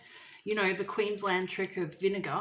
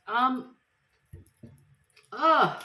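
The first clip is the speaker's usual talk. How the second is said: pained groan; 5 semitones higher, 8 dB louder than usual. Real, not acted. Eyes stinging from onions.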